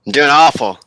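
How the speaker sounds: rough morning after drinking; hungover. Real, not acted. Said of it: "nursing a hangover".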